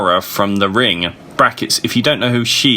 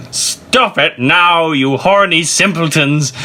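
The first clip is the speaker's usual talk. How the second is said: shout; 6 semitones higher than usual. A narrator's reading. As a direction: bellow at the students, drawn out. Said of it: "shouted Professor McGoggle".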